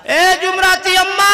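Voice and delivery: high loud call; husband shouting again. Acted. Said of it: "फिर आवाज लगाया बेचारा".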